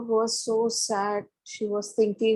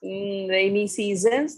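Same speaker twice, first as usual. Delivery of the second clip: usual speaking voice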